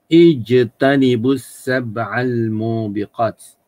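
ijtanibu sab'al mubiqat drawn out slowly, ending stopped on mubiqat; slow Arabic recitation. Shown as a demonstration. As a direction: recite it slowly, stopping the last word dead. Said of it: "kalau kita mati kat sini". (bacaan perlahan, matikan hujung mubiqat)

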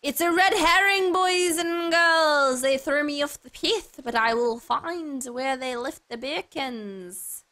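high, loud, stretched-out proclamation to an audience; hammed-up adventure host. Streamer telling boys and girls about a red herring, hammed-up. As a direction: announce it like a children's show host, drawing words out.

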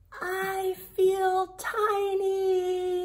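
high, loud, long-held call; drawn-out shout. A narrator's reading. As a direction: shout it slowly across a great distance. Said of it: "And Sophie shouts".

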